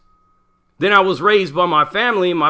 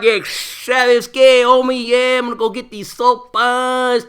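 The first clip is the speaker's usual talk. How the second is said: slow, drawn-out mock Chicano homie accent; fake Chicano accent; 4 semitones higher, slower than usual. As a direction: ham up a wannabe Chicano accent, slowly. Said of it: "talking like this".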